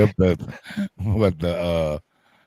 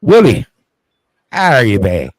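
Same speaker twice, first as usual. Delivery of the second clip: loud greeting; 3 semitones higher, 7 dB louder than usual. Real, not acted. Greeting guest loudly.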